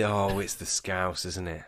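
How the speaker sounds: ordinary talk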